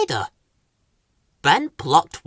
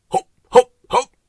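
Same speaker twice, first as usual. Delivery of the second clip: short, loud, high bouncy words; staccato little jumps; hopping; 10 semitones higher, 7 dB louder than usual. A narrator's reading. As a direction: bounce each hop separately. Acting out hops on rope.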